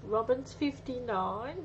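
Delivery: ordinary delivery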